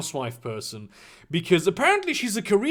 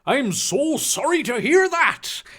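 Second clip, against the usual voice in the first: high, hammed-up mock sympathy; sarcastic; 7 semitones higher, 3 dB louder than usual. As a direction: overdo the sympathy with ironic pitch. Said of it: "Hagrid uttered ironically, sarcastically".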